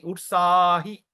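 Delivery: utsahi with a long, held middle A; pulled vowel. Shown as a demonstration. Teacher repeats the example for students, drawn-out vowel. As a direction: draw out the A of utsahi, slowly. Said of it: "you pull the vowel".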